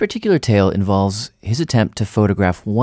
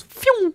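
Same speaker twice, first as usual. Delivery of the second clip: high airy phew of something zipping off; a quick high whistle for the penguin darting away; playful high phew; whoosh noise. Retold or imitated. Mimicking penguin shooting away.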